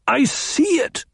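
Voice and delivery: sudden high, strained insistence; touch of madness. A narrator's reading. Paul insisting to his mother, on the edge of madness. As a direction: let it tip into madness. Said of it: "She heard madness in his voice".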